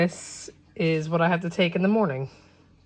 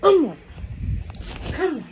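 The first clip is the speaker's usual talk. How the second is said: worked-up mommy coaxing; exasperated coaxing; 5 semitones higher, 5 dB louder, slower than usual. Real, not acted. Coaxing a fussing baby.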